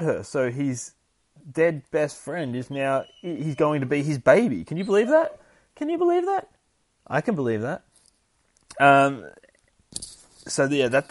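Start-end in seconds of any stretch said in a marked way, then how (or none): 4.6-5.4 high, loud rising disbelief; stunned disbelief. Real, not acted. Streamer reacting to a twist, high incredulous voice. Mock outrage at plot twist. 5.8-6.5 very high squeal of disbelief; shrieking disbelief. Real, not acted. Streamer repeating his shock, much higher squeal.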